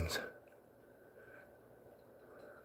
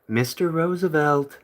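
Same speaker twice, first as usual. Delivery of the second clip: ordinary talk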